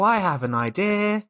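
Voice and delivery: ordinary delivery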